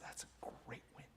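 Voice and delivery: soft breathless whisper of praise. Retold or imitated. Coach whispering praise to an upset client. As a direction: hush it like a secret win.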